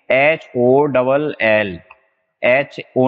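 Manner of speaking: each letter drawn out, slow; slow dictation of letters; spelled out slowly. Shown as a demonstration. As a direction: spell it slowly so the class can write it. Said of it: "H A double L O W E D".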